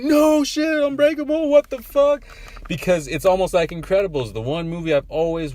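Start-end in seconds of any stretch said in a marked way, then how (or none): 0.0-2.2 loud, high amazed outburst; hyped disbelief. Retold or imitated. Friend reliving the theater moment, loud high excited outburst. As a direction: shout it like the twist just landed. Retelling his hyped reaction.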